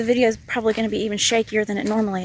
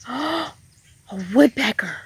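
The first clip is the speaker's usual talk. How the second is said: surprised gasp; 3 semitones higher than usual. Real, not acted. Spots woodpecker.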